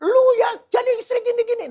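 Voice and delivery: high-pitched mock yell at a wife; yelling husband. Shown as a demonstration. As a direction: shout it high, like scolding a spouse. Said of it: "ngomong yang teriak-teriak".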